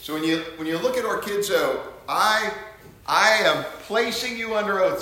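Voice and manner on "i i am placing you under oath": high priest's oath. Retold or imitated. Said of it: "says the high priest".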